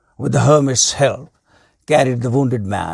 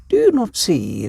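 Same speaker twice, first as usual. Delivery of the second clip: wise hermit; 7 semitones higher than usual. A narrator's reading. Hermit explains to king.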